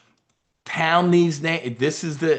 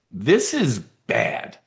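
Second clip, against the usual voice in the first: very slow, each word drawn out; drawn-out ominous warning; much slower than usual. Shown as a demonstration. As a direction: spell it out slowly so they sit up. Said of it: "Whenever you hear me say that".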